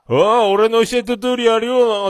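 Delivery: imitating a loudmouth, high and drawn out; loud, slow mock boast; loud bragging. Teasing friend about boasting at the counter, loud bragging imitation. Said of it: "でかい声で言いながら".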